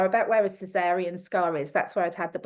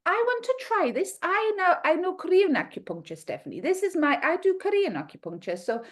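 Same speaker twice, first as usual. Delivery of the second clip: higher, put-on Ukrainian accent; hammed-up Ukrainian accent, much higher; 9 semitones higher than usual. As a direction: imitate the Ukrainian professor confessing with pride. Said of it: "excuse the terrible accent".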